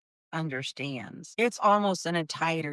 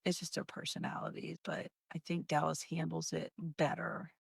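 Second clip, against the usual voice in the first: soft breathy aside, much softer; whispered aside; 9 dB quieter than usual. Real, not acted. Mother talking about her daughters, in a soft whispered aside. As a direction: confide it half under the breath.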